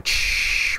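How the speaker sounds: whispered shush. Retold or imitated. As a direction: hush it like a hiss of air. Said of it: "whispering up the air in the background".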